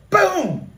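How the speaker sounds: high, punched crash noise falling away; imitated crash boom. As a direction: make the car impact with your voice. Imitating the cars colliding.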